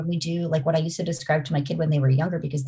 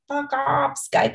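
higher, half-mock curse at the software; retold angry snap; 9 semitones higher, slower than usual. Mom snapping at Skype, higher mock-angry curse. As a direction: fling it at the laptop, higher pitched. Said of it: "I was like".

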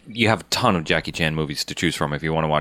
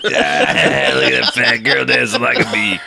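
put-on drunk voice; 9 semitones higher, 6 dB louder than usual. Mocking drunk viewer.

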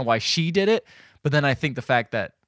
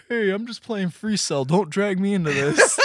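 higher, slowed and a bit put-on; a dodging dad, slightly higher; lightly voiced dad; 8 semitones higher, 4 dB louder than usual. Dad at the computer ducking the fight, lightly voiced.

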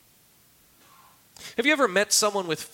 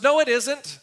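stubborn knight; 8 semitones higher, 5 dB louder than usual. Retold or imitated. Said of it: "He goes".

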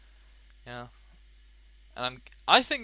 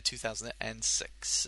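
ordinary talk, nothing marked

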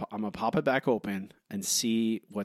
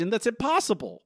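incredulous; 9 semitones higher than usual. Real, not acted. Ranting about the episode's logic.

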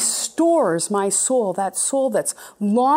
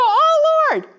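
much higher, louder startled delight; delighted surprise; 11 semitones higher, 5 dB louder than usual. Retold or imitated. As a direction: gasp with joyful surprise at the Lord. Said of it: "And we go".

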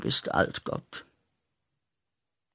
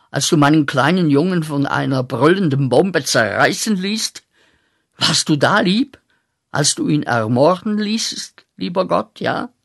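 wütende Anklage; 4 semitones higher, 5 dB louder than usual. Acted. Anklage gegen Gott.

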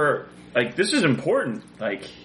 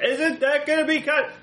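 shouting over machinery; 8 semitones higher than usual. Acted. Speaking over loud generator.

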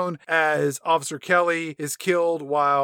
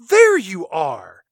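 slow, loud nurse; 3 semitones higher, 8 dB louder, much slower than usual. Retold or imitated. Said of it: "She says to him".

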